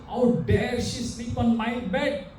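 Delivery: high, slow mock outrage; acted indignation. Master aghast, acted.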